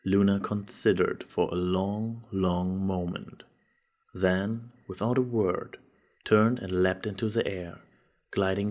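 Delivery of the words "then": ordinary delivery, nothing marked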